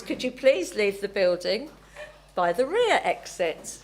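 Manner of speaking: a playful flight attendant asking everyone out; air hostess. Acted. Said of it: "to do my air hostess impression".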